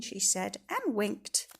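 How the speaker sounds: usual speaking voice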